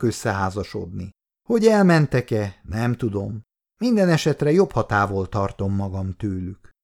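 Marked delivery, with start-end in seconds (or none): none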